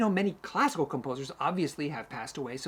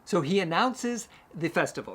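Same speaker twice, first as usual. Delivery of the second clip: ordinary talk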